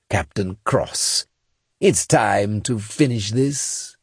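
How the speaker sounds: slow, cold alien ruler passing judgment; drawn-out, menacing regal voice; alien emperor voice. A narrator's reading. Crota continues speaking.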